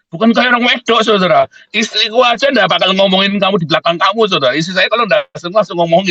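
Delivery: preacher's high scolding; emphatic rebuke. Real, not acted. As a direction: scold the gossipers.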